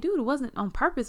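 high-pitched defensive protest; indignant retold excuse. Retold quarrel, defending herself.

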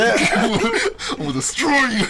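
hammed-up threat through laughter; mock menace. Grown man to little kid, mock menace.

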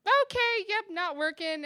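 high, loud mock agreement; exasperated acceptance. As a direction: agree loudly, throwing up the pitch. Curtly accepting breakup, upset.

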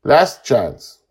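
loud, stern final warning; no warmth. A narrator's reading. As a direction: deliver the ultimatum coldly. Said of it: "this time with no warmth at all".

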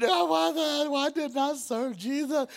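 high, comic wailing lament; mock crying. Boy left behind crying in living room, mock crying. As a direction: bawl it like a baby, hammed-up. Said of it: "crying like a baby".

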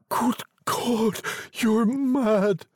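high-pitched, appalled cry; horror-struck. A narrator's reading. Husband appalled at his wife's wish, horrified. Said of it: "struck with horror".

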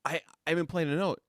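high-pitched, halting disbelief; bewildered protest. Retold or imitated. Student scolded before playing a note, bewildered.

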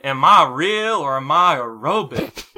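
slow put-on Southern drawl. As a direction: drawl it like a confused old prospector. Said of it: "do it with the southern drawl".

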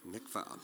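"Mikvah" said very low and soft, MIK-vah; Hebrew word mikvah. Shown as a demonstration. Preacher introducing the Hebrew word, hushed. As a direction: drop to a hush for "Mikvah". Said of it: "the next word she wants to hear is".

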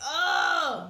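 loud, frustrated "ugh"; loud groan of frustration; exasperated groan. Real, not acted. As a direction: groan out the annoyance. Exasperated at the preacher.

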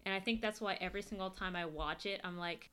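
ordinary talk, nothing marked

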